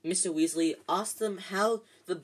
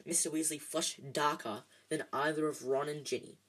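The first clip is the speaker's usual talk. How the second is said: ordinary delivery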